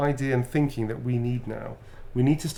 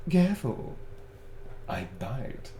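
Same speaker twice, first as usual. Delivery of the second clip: cheeky flirt; 4 dB quieter, slower than usual. Shown as a demonstration. Signing a flirty line for a fan, cheeky and teasing. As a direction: tease the fan playfully. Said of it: "something cheeky, sassy".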